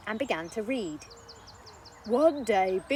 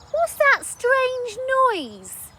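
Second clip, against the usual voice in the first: put-on very high, slow wondering boy; boy hearing a strange noise; puzzled child; 9 dB louder, much slower than usual. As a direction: ask slowly like a puzzled kid.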